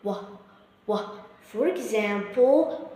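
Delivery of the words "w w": W as a short wa sound; phonics sound W. Shown as a demonstration. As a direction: round the lips for wa. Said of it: "We pronounce it W".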